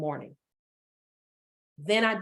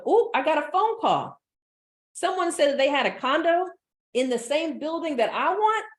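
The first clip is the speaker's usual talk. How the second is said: excited gush; 7 semitones higher, 3 dB louder than usual. Pretend. Imagined good news phone call.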